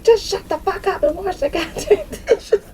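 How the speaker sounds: high voice breaking into laughter; squeaky, laugh-choked scolding; laughing meme quote. Retold or imitated. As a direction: quote the meme while cracking up. Quoting a meme while laughing.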